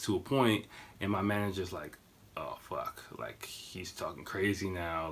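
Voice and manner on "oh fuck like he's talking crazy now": low, soft muttered dismay; muted dismay. Retold or imitated. Manager realising the man is talking crazy, muted dismay. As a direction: quietly retell a sinking realisation. Retelling manager's alarmed reaction.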